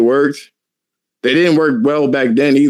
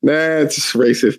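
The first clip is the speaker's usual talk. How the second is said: drawn-out mock disapproval; slow; much slower than usual. Real, not acted. Scolding himself for a joke, slow. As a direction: draw it out slowly. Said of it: "I apologize".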